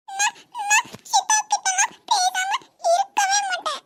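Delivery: put-on high, weepy childish protest; a little girl insisting through tears; tearful child. Niece tearfully protests to her mother.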